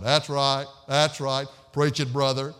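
loud heckler stuck on one low note; monotone amen-man. Retold or imitated. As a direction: drone it out loudly without inflection. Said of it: "It was all monotone".

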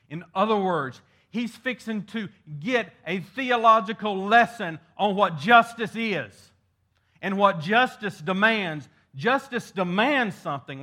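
fervent preacher lifting his voice on justice; preaching at full force. Acted. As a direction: drive the point home from the pulpit.

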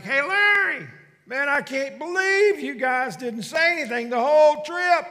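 high, loud holler of disbelief; hollering to the back. Retold or imitated. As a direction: shout it back to your passengers, amazed. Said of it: "he hollers in the back".

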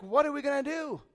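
acted panic, high and loud; freaking out. Servant who has found an army surrounding them, acted freaking out. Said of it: "He's freaking out".

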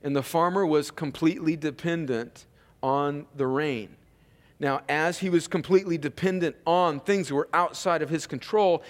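ordinary delivery

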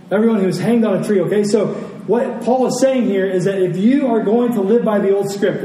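ordinary talk

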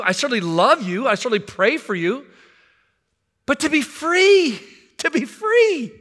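sermon climax, fervent; impassioned preaching. Acted. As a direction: proclaim it from the pulpit. Preaching at full force.

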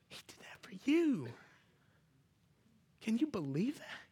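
whispered awe; hushed whisper. Acted. Pastor whispering awe to the congregation. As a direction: lean in and whisper it. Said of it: "hushed after hymn".

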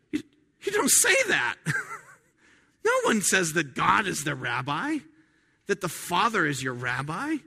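high-pitched shocked objection; offended. Acted. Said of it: "it's offensive".

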